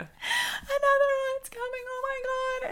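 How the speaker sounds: crying out in labor pain; crying panic. Retold or imitated. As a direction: sob it out as the pain hits. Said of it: "And she was like".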